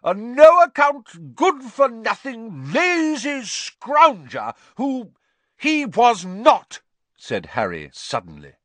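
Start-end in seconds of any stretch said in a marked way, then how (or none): 0.0-5.1 high, slow, drawn-out insults; contemptuous tirade. A narrator's reading. Aunt abusing the boy's late father, scathing. 5.6-6.8 high, loud, slow and defiant; angry interruption. A narrator's reading. Boy defending his father to aunt, furious. Harry angrily interrupts the insult.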